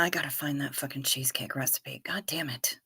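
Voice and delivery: frustrated whisper under the breath; whispered cursing. Real, not acted. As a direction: hiss the curse quietly to yourself. Frustrated aside about lost recipe.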